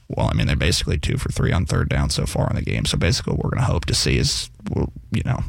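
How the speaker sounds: very low mumbled coach impression; Bill. Guest playing a coach answering the sideline reporter, low impression. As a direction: answer far down in pitch, imitating a terse coach. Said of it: "Like, I can do Bill".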